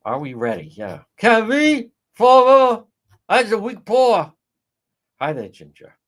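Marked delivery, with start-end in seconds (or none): 1.2-4.3 high, loud mock Fall River voice; mocking Fall River accent. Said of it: "Yeah, the accent".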